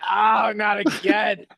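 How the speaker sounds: high, drawn-out mock yell; gargoyles yelling. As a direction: groan it out slowly like an exasperated gargoyle. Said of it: "you hear the gargoyles from outside yelling".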